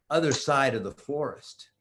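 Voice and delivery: plain reading voice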